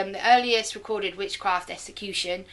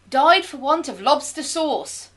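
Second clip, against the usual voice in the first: dramatic; 7 semitones higher, 6 dB louder, slower than usual. Acted. Announcing the ridiculous death phrase.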